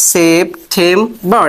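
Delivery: সেপ্টেম্বর very slow, p plain and unaspirated; সেপ্টেম্বর slow, syllable by syllable; slow syllable demo. Shown as a demonstration. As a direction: spell out সেপ্টেম্বর slowly, with no puff on the p. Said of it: "সেটা আবার কেমন".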